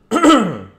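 loud self-important throat clear; pompous throat clearing. Acted. Pompous beadle about to speak.

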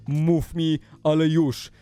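restrained firm demand. Acted. YouTuber repeating the demand to stalker, controlled firm insistence. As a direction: press it firmly without yelling.

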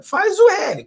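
mocking slogan. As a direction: throw the slogan back at them in scorn. Mocking Lula voters' slogan. (zombando, bem mais agudo e alto)

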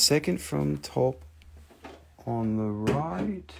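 "Second from top on the right" drawn out word by word; patient slow repetition of "Second from top"; slow and spelled out. Shown as a demonstration. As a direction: say it slowly, as to someone lost.

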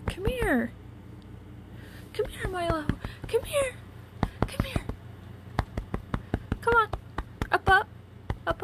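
high call to a pet. Real, not acted. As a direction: coax the cat up with high calls. Calling the cat again.

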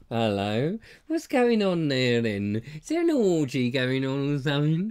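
slow, stretched-out prying voice; nosy caller act. Acted. Kid peering into a friend's house, hammed-up nosy voice. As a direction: draw the words out as a hammed-up snoop. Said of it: "You go".

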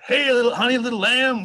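loud mock-folksy address. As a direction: call out like a patronizing old-timer. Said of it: "That's how he talked".

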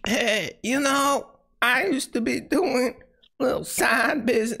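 high, slow parody of the guest; mocking imitation. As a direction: drag out the words to make fun of him. Mimicking the guest's side-business story.

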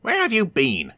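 high, sharp demand; cross little engine asking where he was; Thomas demanding. Acted. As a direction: snap at the late engine. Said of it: "asked Thomas".

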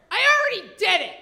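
loud, high, fast mock shout; irritated yell. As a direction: imitate an annoyed woman yelling it out. Busy woman yells at her.

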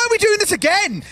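high-pitched disbelief; incredulous exclamation. Real, not acted. Caster baffled by a repeated tactic, incredulous exclamation. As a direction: protest in laughing disbelief, pitch shooting up.

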